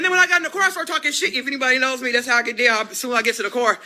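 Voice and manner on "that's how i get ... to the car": usual speaking voice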